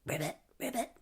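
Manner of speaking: soft croaked ribbits; timid little ribbit; frog croak. Retold or imitated. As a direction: croak quietly like a stage-struck frog. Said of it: "when he has to perform, he's like".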